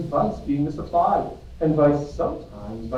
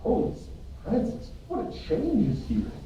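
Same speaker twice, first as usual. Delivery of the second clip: astonished exclamation; 8 semitones higher than usual. Acted. Friar astonished at Romeo's news.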